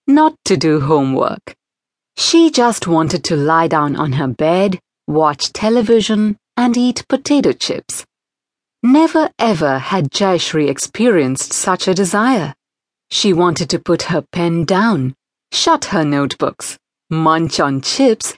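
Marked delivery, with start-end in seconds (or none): none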